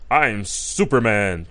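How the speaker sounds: low, slow superhero voice; Superman. Retold or imitated. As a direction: announce yourself as the hero, drawn out and deep. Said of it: "type voice".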